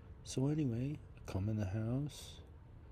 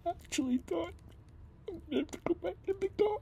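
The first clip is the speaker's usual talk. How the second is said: high, fast, cracking with upset; words spill out near tears; real distress, pitched far up; breaking voice; 3 dB louder, much faster than usual. Real, not acted. Despairing, considering sleeping in car.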